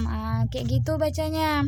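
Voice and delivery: ordinary delivery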